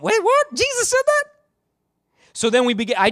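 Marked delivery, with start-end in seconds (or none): 0.0-1.2 put-on squeaky, much higher child's disbelief; mimicking a stunned little kid, pitched way up; a dad squeaking out his child's outrage; shocked kid voice. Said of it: "my kids were like".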